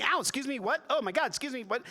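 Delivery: high, loud, startled complaints; imitating a shoved crowd protesting; jostled bystanders. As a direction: yelp it as if being elbowed aside. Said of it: "people going".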